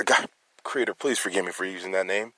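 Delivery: quietly emotional plea to God. Real, not acted. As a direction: ask forgiveness softly, moved. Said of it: "I'm getting a little emotional here".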